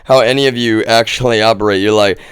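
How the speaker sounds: ordinary talk, nothing marked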